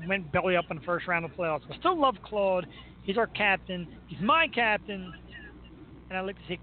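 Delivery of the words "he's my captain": ordinary talk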